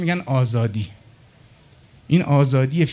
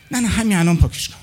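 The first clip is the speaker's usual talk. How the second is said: pleading nephew; 4 semitones higher than usual. Retold or imitated. Nephew begs to delete recording.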